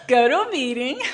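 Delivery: imitating the sponsor's pat answer through a laugh; tossed off with a chuckle, like it's obvious; laughing sponsor quote. Sponsor brushing off money worries, said with a laugh. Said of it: "And they'd say".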